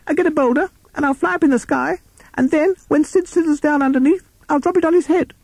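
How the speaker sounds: very high-pitched bat voice; eager squeaky Bat. Acted. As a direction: squeak out your plan as a tiny bat. Bat lays out plan.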